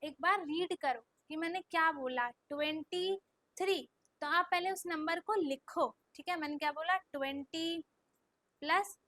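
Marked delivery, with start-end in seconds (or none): 2.5-3.8 TWENTY and THREE split by a pause; slow dictation. Shown as a demonstration. Teacher has pupils read the number slowly, slow dictation. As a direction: say twenty, pause, then three. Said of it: "आप उसको धीरे धीरे".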